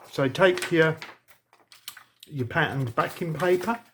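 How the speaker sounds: talking through a mouthful; words round a bite of food; mouth full. Real, not acted. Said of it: "I'm talking with my mouth full now".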